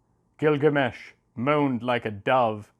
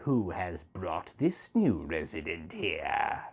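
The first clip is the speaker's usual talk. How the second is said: stern glare; 6 semitones lower, 6 dB quieter, slower than usual. A narrator's reading. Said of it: "she glared and said".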